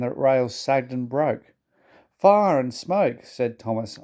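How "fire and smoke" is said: high, slow shocked cry; dismayed exclamation. A narrator's reading. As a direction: gasp out in dismay. Thomas in trouble.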